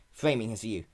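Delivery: usual speaking voice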